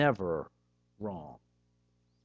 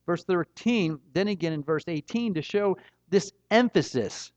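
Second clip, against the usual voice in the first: ordinary talk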